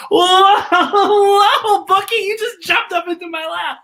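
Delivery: high, loud startled whoa breaking into a laugh; mock surprised laugh. Host startled as puppet Bookie leaps into her lap, playful surprised laugh. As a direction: act caught off guard, then giggle.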